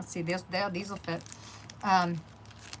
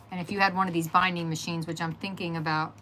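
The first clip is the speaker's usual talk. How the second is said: ordinary delivery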